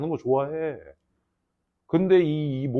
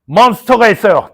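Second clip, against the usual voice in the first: mock ad pitch; 2 semitones higher, 11 dB louder than usual. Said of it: "이, 이런 거 하지 마".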